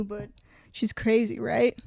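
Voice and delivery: ordinary delivery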